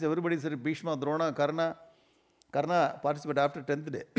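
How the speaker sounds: usual speaking voice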